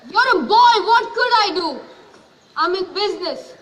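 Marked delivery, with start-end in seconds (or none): 0.1-1.8 raised, desperate plea; anguished shout. Acted. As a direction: shout it out in despair. Father defends himself, shouting.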